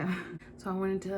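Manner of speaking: normal talking voice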